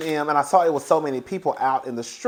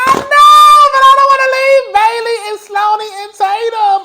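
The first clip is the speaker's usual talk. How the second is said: high, loud whining protest; upset whine; 13 dB louder than usual. Retold or imitated. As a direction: wail it like a kid who won't leave. Retold reply to sister, upset.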